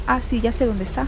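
ordinary delivery

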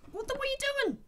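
very high startled outcry; shocked squeal. Real, not acted. Shocked as the woman appears, a high squealing outcry. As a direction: shriek in disbelief.

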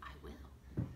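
hushed, breathy whisper; a stunned little whisper. A narrator's reading. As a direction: whisper it like a secret. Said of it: "and then he whispered".

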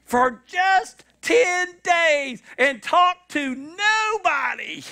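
fed-up fantasy. Retold or imitated. Voicing exhausted listener's thought.

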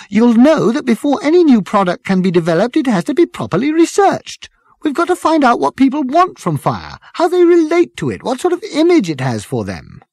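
put-on high, loud, strident girl's voice. A narrator's reading. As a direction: cut in stridently, pitched right up. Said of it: "the girl with the strident voice".

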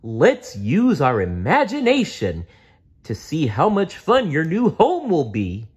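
high cheery dinosaur invitation; playful Barney. Acted. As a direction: invite a kid to pretend.

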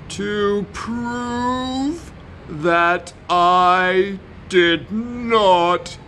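very slow, hesitant words; slowly, nervously. A narrator's reading. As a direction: drag each word out nervously. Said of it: "He spoke slowly".

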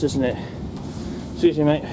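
ordinary talk, nothing marked

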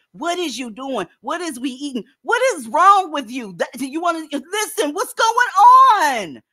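a hint of mocking the grammar; mocking impression. Host mocks women who say is for are, lightly voiced. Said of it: "You sound like you literally have ... when you say".

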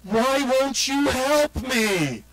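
high, drawn-out wailing plea; desperate retold shout. Son calls after mom as she drives away, pleading shout. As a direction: imitate a kid shouting after the car, stretching every word. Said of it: "he s-, literally shouted".

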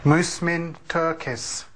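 slow careful Musmin Turkis; Native word demo. Shown as a demonstration. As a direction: say Musmin Turkis slowly, stressing Mus. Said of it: "Why am I saying it in an accent".

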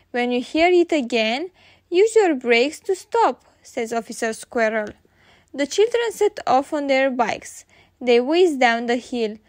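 ordinary delivery

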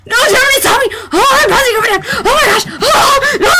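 high, frantic wailing; hammed-up panic. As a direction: panic out loud, pitch shooting up. Panic at being seen.

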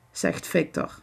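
ordinary delivery, nothing marked

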